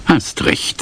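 hoarse, unvoiced, barely voiced reply; rough voice. A narrator's reading. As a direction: rasp it out, voice almost gone. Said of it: "Seine Stimme war rau". (rau und tonlos geflüstert)

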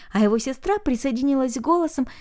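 ordinary delivery

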